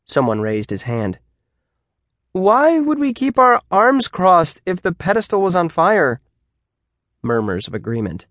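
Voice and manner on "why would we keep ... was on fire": high-voiced puzzled student; questioning student. A narrator's reading. Student who raised his hand challenges teacher, high and querying. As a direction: pipe up to poke a hole in it.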